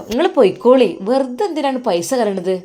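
high, loud telling him to go; angry dismissal. Acted. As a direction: shout him off, scornful and loud. Wife quarrels with husband. (ഉറക്കെ ദേഷ്യത്തോടെ)

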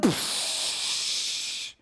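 unvoiced scoffing psh through the teeth; angry huff. Husband at his wife, an annoyed psh. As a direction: blow out an irritated psh. Sudden anger in hypothetical.

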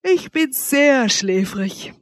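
words stretched through a big yawn; loud yawn. A narrator's reading. Wanda yawns at her desperate lover, very sleepy. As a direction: yawn it out, high and very slow. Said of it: "Dann gähnte sie laut".

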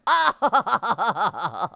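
low, rolling laugh; gloating laugh. Real, not acted. Player gloats over burning booties, gloating laugh. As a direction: laugh in a long run of low pulses.

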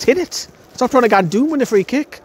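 high-pitched exasperated cries at the striker; frustrated yelling at players. Retold or imitated. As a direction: plead with him to just shoot.

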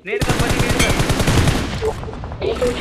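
loud, insistent push; urgent shout. Real, not acted. Urging Bunny to finish the knocked enemy, loud insistent shout. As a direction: shout the order to finish him.

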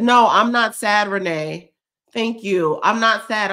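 loud, insistent no I'm not sad; emphatic denial. Real, not acted. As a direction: push back loudly, overwhelmed. Insisting she isn't sad.

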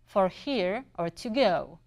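slow, spaced-out imitation of a Southern accent. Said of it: "in the same heavy accent, but slower".

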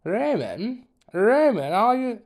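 high loud calling. Acted. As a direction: call him sharply by name. Teacher calling the daydreamer.

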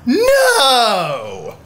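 high, drawn-out scandalized cry; mock shock. Friend hears of the disagreement, mock shocked. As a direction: gasp it out, hammed-up and falling.

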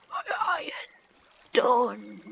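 slow whispered My iron's done. Shown as a demonstration. Gamer announcing finished iron in a slow whisper.

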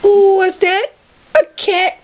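high squeaky excitement; high excited voice. Pretend.

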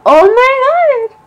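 high, loud and drawn-out thrilled cry; loud high squeal of joy; excited squeal. Real, not acted. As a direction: shriek it with sheer delight. Thrilled reaction to the card.

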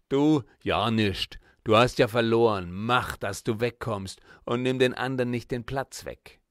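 put-on loud, harsh stall owner shooing the child off; gruff Berlin scolding. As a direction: bark her away from the stall. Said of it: "Die barsche Art der Frau".

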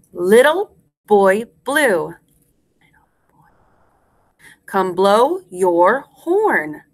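very slow, word by word; spaced-out nursery rhyme for repeating; slow rhyme recitation. Shown as a demonstration. Teacher leads class in Little Boy Blue, slowly word by word.

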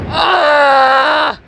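loud, high scream. Real, not acted. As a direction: scream on cue. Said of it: "Wir schreien jetzt".

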